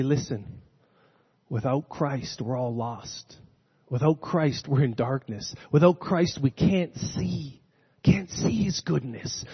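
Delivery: hushed and sincere, the opposite of scolding; gentle truth. Acted. Giving truth gently instead.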